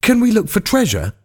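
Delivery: high, eager question; keen and thrilled asking; excited. A narrator's reading. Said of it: "Roger asked excitedly".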